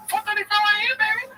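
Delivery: high mock hype bro yell; Schultz impression. Mocking a podcaster's hustle talk, high mock hype. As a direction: push it up high like a hyped grind guru. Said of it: "Schultz is his character".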